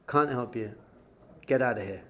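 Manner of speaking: low, quiet brush-off; curt dismissal. Retold or imitated. Rebbe turning away a disciple, quietly dismissive.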